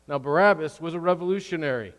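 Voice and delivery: ordinary talk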